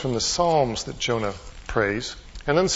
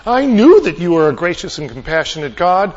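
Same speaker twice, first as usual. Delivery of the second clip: loud, slightly raised, mildly indignant complaint to God; acted angry appeal, pushed louder; angry prayer; 4 semitones higher, 6 dB louder than usual. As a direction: pray it as a forceful protest. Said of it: "He's angry at God".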